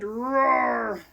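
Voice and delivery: mock bear roar. A bear roars at the children, playful mock roar. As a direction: give a playful growling roar. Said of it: "And then the bear said".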